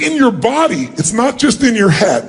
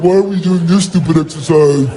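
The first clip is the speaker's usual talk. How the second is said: jaded adult; 5 semitones lower than usual. Retold or imitated. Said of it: "like an adult".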